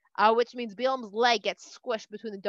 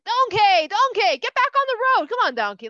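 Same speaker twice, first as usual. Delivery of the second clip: high, loud frustrated yell; yelling at donkey; 10 semitones higher, 8 dB louder than usual. Acted. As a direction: shout the donkey back onto the road. Bilam yelling at his donkey.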